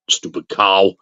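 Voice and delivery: low, muttered stupid cow; muttered insult. Retold or imitated. Captain muttering about the woman, low grumble. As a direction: grumble it under your breath. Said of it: "and he just goes".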